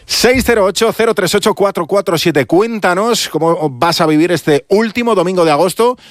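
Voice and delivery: high, projected announcer read of a phone number; anuncio del teléfono. Acted. Locutora lanza el teléfono. (anuncio radiofónico agudo del número)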